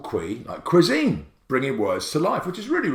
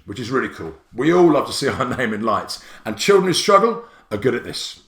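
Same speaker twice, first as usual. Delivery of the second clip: ordinary talk, nothing marked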